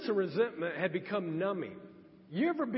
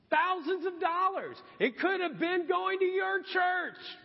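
much higher and louder, slow and indignant; indignant outburst; 7 dB louder, slower than usual. Retold or imitated. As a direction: protest to God at full pitch, dragging it out. Arguing back with the Holy Spirit.

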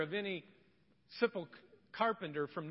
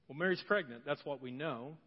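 normal talking voice

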